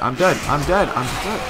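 high, frustrated outburst; exasperated yelling. Real, not acted. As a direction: call it out helplessly as defeat closes in. Panics as character is being killed.

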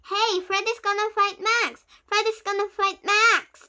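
usual speaking voice

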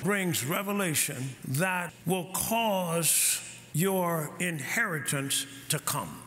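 slow, low teaching. Acted. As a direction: let each phrase settle.

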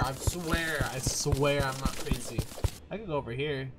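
ordinary talk, nothing marked

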